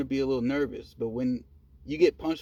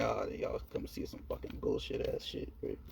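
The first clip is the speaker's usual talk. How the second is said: whispered mocking thought; 4 semitones lower, 9 dB quieter than usual. Mocking thought about their movie.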